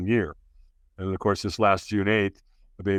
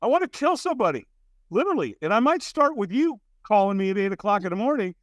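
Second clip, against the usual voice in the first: angry retort; 11 semitones higher than usual. Retold or imitated. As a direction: bite the caller's head off. Hungover man snapping at caller.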